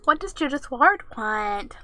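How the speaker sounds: ordinary talk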